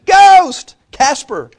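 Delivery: high, loud hammed-up cry of fright; mock panicked shout. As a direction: yell in playful fake panic. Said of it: "and they're like".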